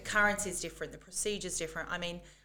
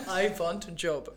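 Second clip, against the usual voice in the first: laughing, heavy accent again; broken English repeated; 5 dB louder than usual. Shown as a demonstration. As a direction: redo it with a grin. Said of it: "Can you, can you do that again?".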